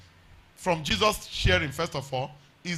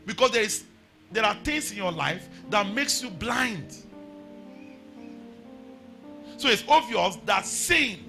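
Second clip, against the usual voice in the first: raised, ringing preacher voice over music; hollering revival preacher; 4 semitones higher than usual. Acted. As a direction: preach it out, climbing higher. Preaching at full force with music.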